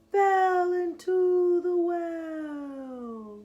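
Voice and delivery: slow, high, stretched-out reveal; drawn out dramatically. A narrator's reading. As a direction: draw out each word like a gasp of shock. Dramatic slow climax of name.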